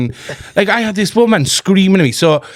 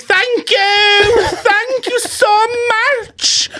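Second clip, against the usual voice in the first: very high, sugary thanks; slow, very high sickly-sweet thank you; mock sweet thanks; much slower than usual. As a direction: squeal it falsetto-sweet through clenched politeness. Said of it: "I'm like".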